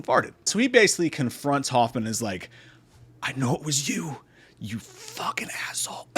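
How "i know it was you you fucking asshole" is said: soft, airless croaky whisper; hole-in-the-trachea voice. Acted. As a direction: struggle to accuse with no voice. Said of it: "he has a hole in his trachea".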